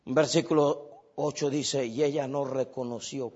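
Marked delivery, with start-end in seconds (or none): none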